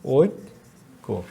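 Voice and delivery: ঐক্য drawn out slowly; dictating ঐক্য slowly; slow dictation. Shown as a demonstration. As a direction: stretch ঐক্য for writing. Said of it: "dictating word slowly".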